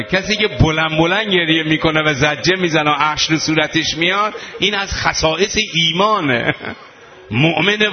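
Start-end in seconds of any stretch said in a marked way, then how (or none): none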